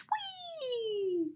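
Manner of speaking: high soft whee; high airy wow. Acted. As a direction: ride the sky with a breathy whee. Jet ride excitement.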